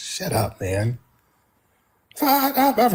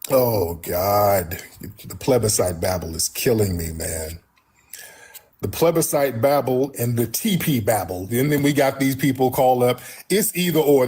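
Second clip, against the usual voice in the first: ordinary delivery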